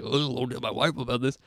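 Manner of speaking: put-on old club comedian voice; Rodney Dangerfield. Patient redoing his joke as Caddyshack comic, put-on voice. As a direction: do a quick comic impression of a one-liner. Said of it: "Rodney Dangerfield".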